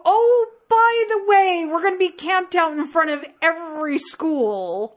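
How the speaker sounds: high, loud, sarcastic pretend heads-up; mock announcement. Host imitating police revealing their plans, mock cheerful announcement. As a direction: announce it with exaggerated helpfulness, loudly.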